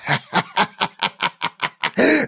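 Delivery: high wild dying man's laugh; wild cackle. A narrator's reading. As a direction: burst into crazed laughter. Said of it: "неожиданно дико расхохотался".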